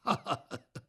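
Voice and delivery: brief amused laugh; amused chuckle. Acted. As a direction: chuckle lightly at his worry. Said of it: "包公一听乐了".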